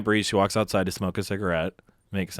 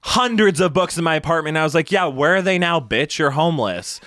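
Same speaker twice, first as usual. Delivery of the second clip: retold yelling; 6 semitones higher, 4 dB louder than usual. Yelling at each other back and forth.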